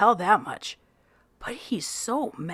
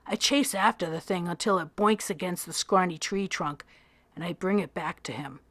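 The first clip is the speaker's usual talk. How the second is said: ordinary delivery, nothing marked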